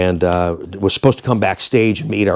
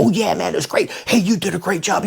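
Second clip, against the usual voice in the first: band members hyping each other; 8 semitones higher, 4 dB quieter than usual. Retold or imitated.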